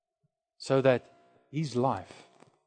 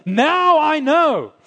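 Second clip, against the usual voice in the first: much higher and louder, slow, proclaiming; fervent preaching; 14 semitones higher, 10 dB louder, much slower than usual. Acted. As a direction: ring it out like a preacher's climax. Preaching climax.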